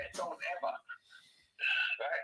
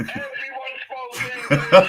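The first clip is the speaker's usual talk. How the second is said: drawn out slowly, much louder, laughing through it; loud laughing repeat; 4 semitones higher, 15 dB louder, slower than usual. Real, not acted. Repeating the punchline to his mate, loud and laughing. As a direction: hammer the punchline home while cracking up.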